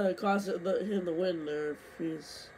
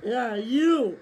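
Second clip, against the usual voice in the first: angry; 4 semitones higher, 8 dB louder than usual. Real, not acted. Rival wrecks his race.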